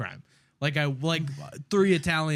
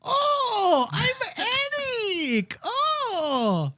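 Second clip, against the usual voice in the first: very high, wailing mock accent; mocking Eric; much slower than usual. Kid teasing Eric before class, mocking accent. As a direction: squeal it as an exaggerated accent caricature. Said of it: "I walk up and I go".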